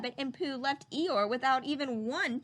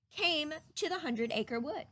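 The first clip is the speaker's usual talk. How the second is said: ordinary delivery, nothing marked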